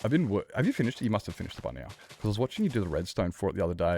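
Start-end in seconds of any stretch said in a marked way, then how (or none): none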